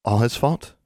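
light, rising, offhand query; casual question. A narrator's reading. Anna questions Alexandra while polishing her wand, casually. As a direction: ask it lightly with a lift at the end. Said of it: "she asked casually".

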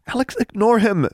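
high-pitched urgent plea; pleading. A narrator's reading. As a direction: plead with her, voice pitched right up. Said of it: "she pleaded".

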